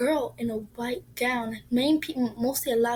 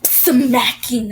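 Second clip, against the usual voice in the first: ordinary talk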